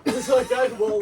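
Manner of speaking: ordinary talk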